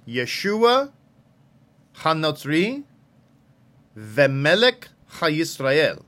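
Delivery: ordinary talk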